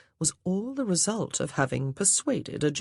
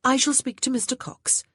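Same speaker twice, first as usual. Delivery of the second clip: confident; 9 semitones higher, 3 dB louder than usual. A narrator's reading. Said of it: "Grace said confidently".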